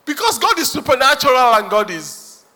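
preaching with force; emphatic preaching. Real, not acted. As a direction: proclaim it to the congregation.

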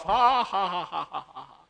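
a shrugging ha ha ha that trails away; carefree laugh. Retold or imitated. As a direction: laugh it off, fading to nothing. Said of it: "I just laugh".

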